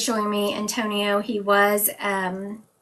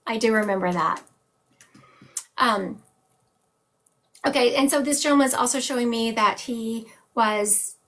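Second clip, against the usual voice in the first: normal talking voice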